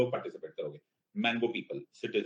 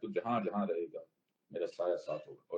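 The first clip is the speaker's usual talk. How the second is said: the film line murmured softer, not sung; soft spoken reprise; 5 semitones lower, 7 dB quieter than usual. Pretend. Teacher softly repeating the lyric to class, quiet. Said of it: "emotional हो sir".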